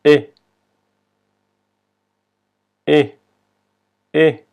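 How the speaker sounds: "E" said as French é, like Spanish e; a pure Spanish-style "e" sound for "E"; accented French E. Shown as a demonstration. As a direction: model the letter "E" as a short, clean é. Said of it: "se pronuncia como la E en español".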